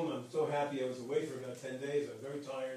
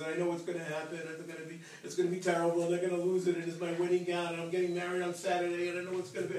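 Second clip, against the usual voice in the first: mock crying, higher run-on wail; imitated tearful panic; 3 semitones higher than usual. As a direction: pour out worries like a distraught bride. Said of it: "then she starts to cry".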